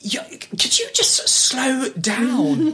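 slow, high mock agent voice. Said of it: "when she went".

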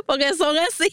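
high-pitched words through giggles; amused, laughing. Real, not acted. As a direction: talk while still laughing for real. Laughing at how children are.

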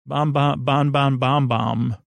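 ordinary talk, nothing marked

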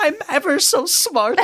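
very high put-on schoolboy voice; Martin. Host echoes the eager pupil quote, high comic impression. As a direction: echo it as a high-pitched boastful pupil. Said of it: "obviously Martin is hilarious".

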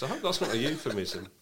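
ordinary delivery

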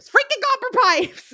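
high exasperated squeak about pipes; mock outrage. Echoing co-host's complaint.

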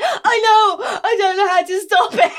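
high, loud, laughing shout; laughing helpless apology; laughing panic. Retold or imitated. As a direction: plead helplessly through laughter. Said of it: "And I went".

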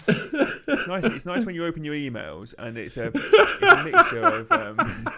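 speaking through high laughter; giggling through the words; laughing. Real, not acted. Laughing while reading abuse emails.

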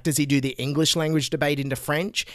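usual speaking voice

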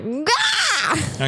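short burst of a yell; fiery yell. Retold or imitated. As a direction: blurt it out like fire. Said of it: "water to my fire 'cause I'm like".

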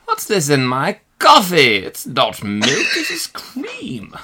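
mock careful diction, high and drawn out; over-enunciate, stretching the last word very high; Michael. Co-host mimicking the clue-giver's diction, hammed-up precise delivery. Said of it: "with Michael's diction, he would say".

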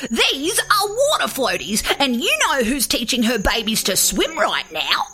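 know-it-all bird voice; excited cockatoo. A narrator's reading. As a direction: squawk out your discovery. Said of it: "Clary didn't wait for a response".

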